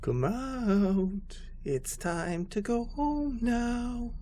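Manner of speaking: high, slow, coaxing creepy voice; creepy man voice. A narrator's reading. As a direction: coax like a creepy man to a child. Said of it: "a very creepy voice echoed".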